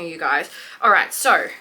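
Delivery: ordinary delivery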